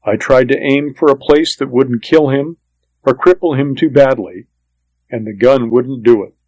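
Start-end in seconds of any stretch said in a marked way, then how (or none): none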